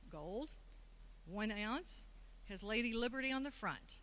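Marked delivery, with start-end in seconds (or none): none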